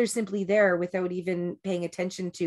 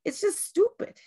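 high, soft, half-whispered mock guru; Sadhguru impression; 10 semitones higher, 4 dB quieter than usual. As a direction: play the laughing guru waving it all away. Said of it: "He goes".

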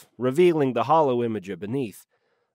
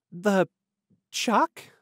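high, halting, puzzled question; trailing off, puzzled; 7 semitones higher than usual. A narrator's reading. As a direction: break off, then lift the word in confusion. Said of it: "she trailed off".

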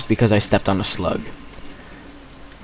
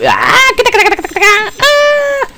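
startled shriek. Real, not acted. Startled by falling leech.

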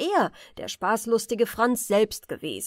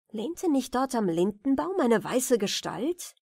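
ordinary delivery

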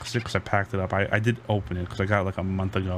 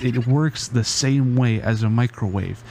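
ordinary talk, nothing marked

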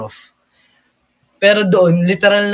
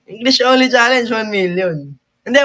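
ordinary talk

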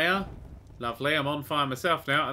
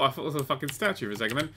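ordinary talk

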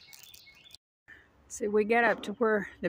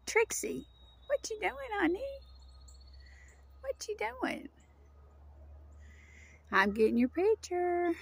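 much higher, cooing pet voice; 11 semitones higher, 3 dB quieter than usual. Real, not acted. Owner calling to her dog Trixie in the woods, high cooing pet talk.

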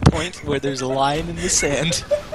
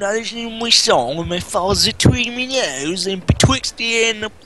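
higher, garbled mock Cockney; 6 semitones higher than usual. Mocking the sweep's song for a friend, bad Cockney. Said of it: "he has the worst Cockney accent".

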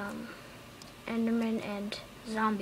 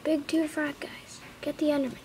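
ordinary talk, nothing marked

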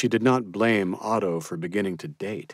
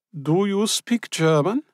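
higher, slow, pointed voice for the Austrian lady; a sharp older woman sizing up a stranger; Frau Schmidt's question; 12 semitones higher, 5 dB louder than usual. A narrator's reading. Frau Schmidt tests the newcomer.

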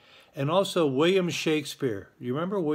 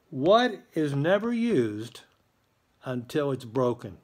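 slow, drawn-out emphatic question; slow riddle read; slower than usual. Acted. Principal slowly poses the riddle of the day to students. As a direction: lean into each word of the riddle.

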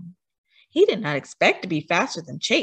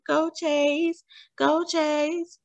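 higher chanted cheer; crowd cheering; 7 semitones higher, much slower than usual. A narrator's reading. Crowd cheering Chase around the track, higher excited chant. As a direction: cheer him on from the stands.

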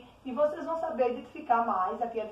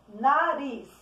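NARIZ called out, RIZ stretched and louder; calling out; 4 dB louder than usual. Shown as a demonstration. Teacher calls the word like a little friend's name, calling out NARIZ. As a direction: call NARIZ like a name, leaning on the last syllable. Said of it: "chamar as palavras como se você estivesse chamando".

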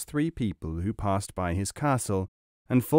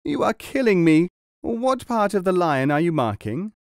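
higher, louder pained cry; moaning complaint; 7 semitones higher, 4 dB louder than usual. A narrator's reading. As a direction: moan out the pain of the needle. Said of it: "began to moan and groan".

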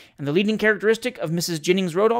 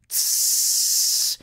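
long imitated fizzing hiss; sustained sibilant hiss; bottle hiss. A narrator's reading. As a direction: hiss like a bottle being opened. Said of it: "went the bottled ales again".

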